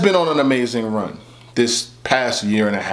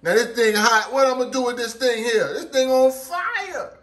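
higher, overexcited complaint about the heat; hot-food bit; 12 semitones higher than usual. Acted. Hamming up the hot thing for the camera, higher and overexcited. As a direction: ham up how hot it is.

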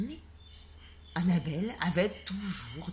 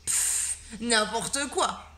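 loud scoff, then slow sulky words; a jealous boy scoffing; dismissive scoff; 3 semitones higher, 7 dB louder, much slower than usual. Acted. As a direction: brush her off, stung. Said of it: "répliqua Nathan".

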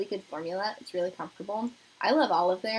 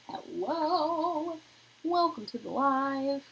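sing-song greeting; 7 semitones higher, much slower than usual. Real, not acted. Greeting new viewer on stream.

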